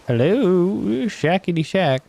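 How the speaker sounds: lightly hammed-up "where are you" call; playful calling out. Calling for the missing shack.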